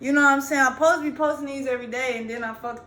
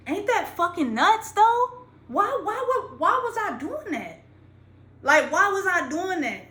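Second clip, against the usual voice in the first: amazed disbelief; 6 semitones higher, 3 dB louder than usual. Real, not acted. Marvels at his childhood habit.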